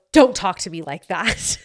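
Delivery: put-on scolding voice, played for laughs; mock-scold as a running inside joke; joking mock rebuke. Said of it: "our inside joke now".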